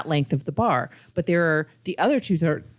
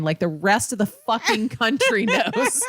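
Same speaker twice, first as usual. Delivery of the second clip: laughing put-down; 6 semitones higher, slower than usual. Retold or imitated. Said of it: "And she goes".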